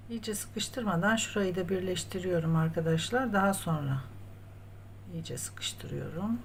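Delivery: normal talking voice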